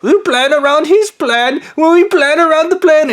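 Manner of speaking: high, sneering parody of the plotters; mocking voice. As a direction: ham up the schemers in a squeaky high voice. Mocking the people watching him.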